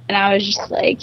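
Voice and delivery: ordinary delivery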